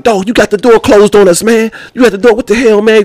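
put-on high, exasperated complaint from an older inmate; annoyed old head. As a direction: gripe at him like a fed-up old-timer. Old head complaining about door.

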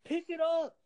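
high, shouted put-down; mock harsh yeller. Coach mimicking a yeller berating his players. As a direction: bark it like a coach cutting a kid down. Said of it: "what are you yelling? Are you yelling like".

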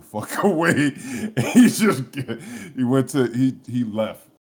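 loud, breaking up with laughter mid-story; laughing. Real, not acted. Cracks up recounting the exit.

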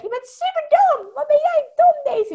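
squeaky ego voice. Shown as a demonstration. The ego calling Daisy stupid in a high squeaky voice. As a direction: squeal it like a silly chipmunk critic. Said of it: "maak er een heel hoog piepstemmetje van".

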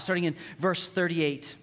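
ordinary delivery

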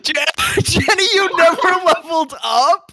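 high, loud, laughing disbelief; cracking up in shock at her. Real, not acted. GM amazed at player's mistake.

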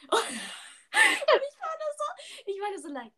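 laughing through the words; giggling while talking; cracking up mid-line. Real, not acted. Laughing while retelling confusion.

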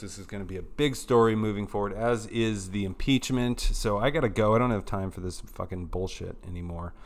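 ordinary talk, nothing marked

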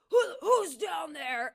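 high, drawn-out challenge called out; mock aggro. Stranger calls down to laughing walkers, mock aggro. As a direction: imitate a meathead hollering threats. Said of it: "tries to be, like, all aggro".

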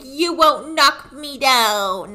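mock stubborn taunt; annoying defiance. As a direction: draw it out to needle him. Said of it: "she's just being really annoying".

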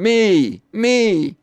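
high, loud yell to get picked; eager yelling. Acted. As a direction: yell it out like a volunteer. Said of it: "start yelling".

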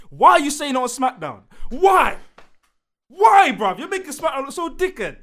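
loud, high-pitched indignant demand; exasperated rant. Real, not acted. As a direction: fire off each why in real frustration. Ranting at the wrestler's storyline.

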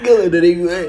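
lips barely moving. Pretend. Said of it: "Tiba-tiba pakai suara perut".

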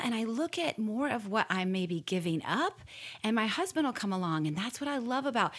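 normal talking voice

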